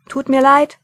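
loud, high apology over the shoulder; shouted back. A narrator's reading. Mumm yelling back to the dwarf while running. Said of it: "schrie er zurück".

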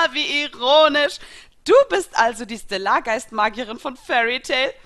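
loud, high, scornful delight; mocking villainess. A narrator's reading. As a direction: gloat loudly at the opponent. Laughing villain mocks the heroine.

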